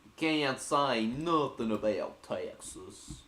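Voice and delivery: slow, drawn-out Texan drawl; hammed-up slow Texas accent. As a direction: stretch every vowel like a mock Texan. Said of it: "When you did that Texan accent".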